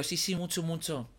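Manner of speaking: soft, held-back yes; hushed reassurance. Retold or imitated. Assuring the caller while parents sleep, hushed reassurance. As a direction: insist softly without waking anyone. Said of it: "como que no puedo gritar".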